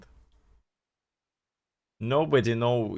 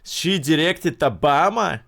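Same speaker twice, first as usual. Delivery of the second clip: higher, louder disbelieving question; surprised question; 5 semitones higher, 5 dB louder than usual. Real, not acted. Streamer mishearing the clip, surprised. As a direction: blurt it out, amazed.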